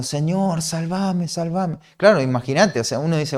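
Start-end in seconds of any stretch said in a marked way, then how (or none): 0.0-1.8 mild, drawn-out plea, not shouted; imitated cry for help. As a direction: act out a sinking man's call with restraint. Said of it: "empezó a dar voces diciendo". (súplica de auxilio imitada, contenida)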